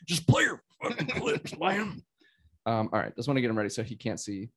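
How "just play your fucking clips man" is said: a hammed-up rough whisper, higher in pitch; mock bossy demand. Host jokingly echoes the co-host ordering clips played, mock bossy. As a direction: bark the order at the guest in jest. Echoing co-host's jab as a mock.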